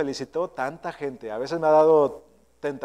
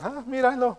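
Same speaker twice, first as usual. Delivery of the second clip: high, softer mock voice of a congregant; grumbling congregant; 7 semitones higher, 5 dB quieter than usual. Congregants remark on the pastor's preaching, high mock aside. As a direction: imitate a churchgoer pointing at the preacher, pitched up. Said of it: "algo que ustedes dicen".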